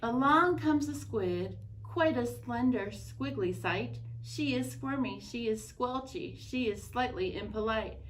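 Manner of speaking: put-on reading a picture book aloud to children; gentle read-aloud narration; story narration. A narrator's reading. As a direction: tell it like story time at the library.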